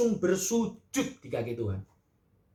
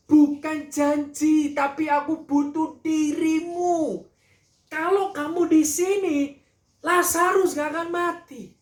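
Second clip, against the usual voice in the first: anguished plea; 12 semitones higher, 7 dB louder, slower than usual. Acted. Marta pleading with Jesus.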